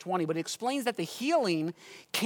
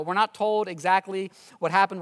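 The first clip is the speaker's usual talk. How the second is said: ordinary talk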